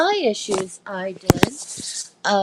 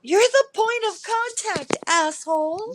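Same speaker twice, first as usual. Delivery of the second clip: indignant retort; 11 semitones higher than usual. Retold or imitated. Retold retort to office.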